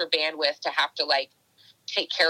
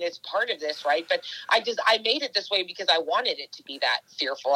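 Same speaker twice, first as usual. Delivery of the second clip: ordinary talk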